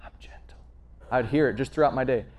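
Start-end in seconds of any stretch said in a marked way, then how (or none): 0.0-0.6 breathy unvoiced whisper of the verse; a soft whisper in the ear. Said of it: "that scripture would just kinda whisper".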